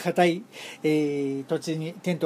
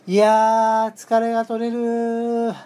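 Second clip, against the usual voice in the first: slow, contented bath sigh; blissful sigh; 2 semitones higher, much slower than usual. Retold or imitated. Soaking in the hot spring, savoring the relief aloud. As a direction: let the tiredness melt out of the words.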